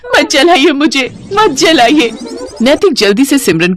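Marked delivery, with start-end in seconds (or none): 0.1-2.1 higher, slow anguished cry; higher pitched terrified wail; screaming in fear. Acted. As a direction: plead desperately, drawn out. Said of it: "जोर जोर से चिल्लाती है".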